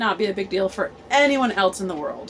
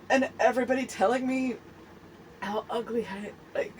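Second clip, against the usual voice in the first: ordinary talk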